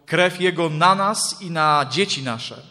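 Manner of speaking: loud, slow, weighty cry; crowd calling out. A narrator's reading. The whole people answering Pilate, calling out. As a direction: call it out slowly, drawing out the words. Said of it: "cały lud zawołał".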